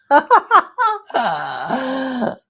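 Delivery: high, loud laugh tailing off. Real, not acted. Laughing out to the viewers at the drawing table, high laugh. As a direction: burst out in a high cackling laugh.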